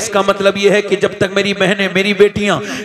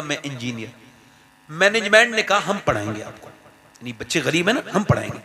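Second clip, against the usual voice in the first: hushed, gentle sermon story voice; soft storytelling; 2 semitones lower, 6 dB quieter than usual. A narrator's reading. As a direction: draw them in softly. (धीमी आवाज़ में कहानी सुनाना)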